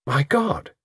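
restrained cry of horror; shocked cry. A narrator's reading. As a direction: exclaim in shock. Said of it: "cried Delengua".

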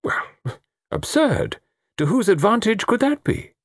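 high, puzzled challenge; baffled, indignant query; incredulous scoff. Acted. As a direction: question it with pitch held high. Satterthwaite scoffing at the suggestion.

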